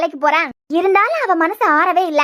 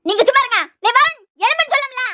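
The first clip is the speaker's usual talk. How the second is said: high-pitched, threatening command; angry wake-up order; 6 semitones higher than usual. Acted. Mother warning Varun to get up, angry wake-up order. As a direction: demand that he get up this instant.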